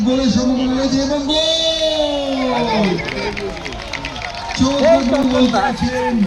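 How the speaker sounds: high, drawn-out goal shout; goal call. Real, not acted. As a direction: stretch the goal cry slowly. Commentator calls a goal. (গোলের উল্লসিত চিৎকার)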